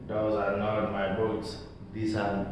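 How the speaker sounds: soft, low, chilling calm; quiet enough to freeze the blood; icy quiet. A narrator's reading. Old bootmaker coldly rejecting the customer's boots, icy quiet. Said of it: "something quiet that froze the blood".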